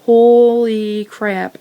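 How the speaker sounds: a stretched, stunned holy crap; shocked. Retold or imitated. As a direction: drag it out in disbelief. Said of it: "and I was like".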